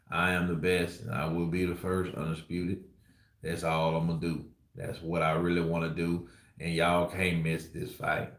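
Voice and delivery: low, soft, slow mumbling imitation of Errol Spence; lazy-tongue Texas drawl. Vlogger imitating Errol Spence's lazy Texas speech for fans. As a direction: slur it lazily like a Texas fighter hyping his bout. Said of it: "they just have a lazy tongue like this".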